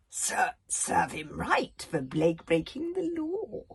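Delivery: slow, sneering jibe; bully sneering at the funeral. A narrator's reading. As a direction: sneer with contempt. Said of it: "sneered Farworm".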